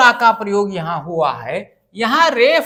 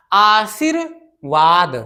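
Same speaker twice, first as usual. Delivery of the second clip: "आशीर्वाद" drawn out slowly, aa-shir-vaad; slow syllable by syllable; much slower than usual. Shown as a demonstration. Teacher spells the word slowly for students. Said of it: "ये हो गया आशीर्वाद".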